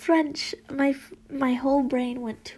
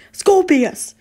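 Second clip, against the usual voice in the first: very loud, raised-pitch bark; yelling; 7 semitones higher, 11 dB louder than usual. A narrator's reading. Father silences his babbling son, loud yell. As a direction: bellow the name to silence him. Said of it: "Mister Draco yelled".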